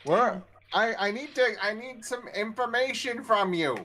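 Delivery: high, insistent character voice. Acted. Thrug asks the orc for information, in-character voice.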